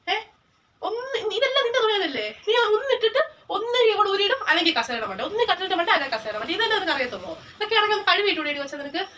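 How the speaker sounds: exasperated nagging over the mess; mother scolding her daughter about clothes piled everywhere. Acted.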